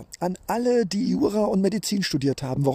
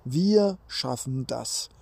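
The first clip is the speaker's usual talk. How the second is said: slow, drawn-out slogan; slow emphatic; 4 semitones lower, slower than usual. Shown as a demonstration. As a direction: stretch each word out, stressing wir. Lauscht rein", then a slow take.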